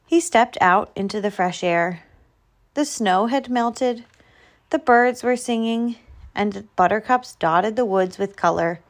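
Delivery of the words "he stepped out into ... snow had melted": plain reading voice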